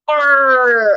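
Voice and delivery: a hint of a pirate growl; mildly piratey, a bit loud. Shown as a demonstration. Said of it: "a pirate".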